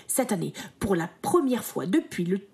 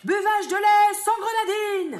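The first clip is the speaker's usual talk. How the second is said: ordre crié haut et lent; adjudant-chef; 11 semitones higher, 5 dB louder than usual. Acted. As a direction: aboyer l'ordre comme un sergent. Ordres militaires. (adjudant qui crie)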